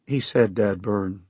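plain reading voice

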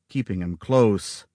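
usual speaking voice

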